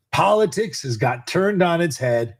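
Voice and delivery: ordinary talk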